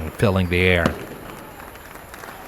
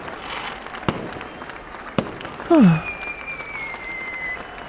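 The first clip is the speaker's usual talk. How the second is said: admiring sigh; 14 semitones higher, 5 dB quieter than usual. A narrator's reading. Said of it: "she mused".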